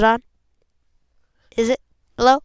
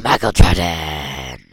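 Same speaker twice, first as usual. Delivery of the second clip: announcer voice; 8 dB louder than usual. Pretend. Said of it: "Do a really good, I'm Michael Jordan".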